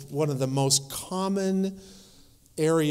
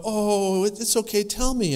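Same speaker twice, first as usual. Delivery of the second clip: higher, coaxing foster-parent voice; nurturing; 5 semitones higher, 3 dB louder than usual. Acted. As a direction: gently coax a child to open up, pitched up. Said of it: "we try to be nurturing".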